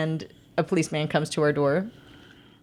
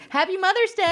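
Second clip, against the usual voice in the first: aunt's ironic quip; 13 semitones higher than usual. Retold or imitated. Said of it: "my aunt turns to her and goes".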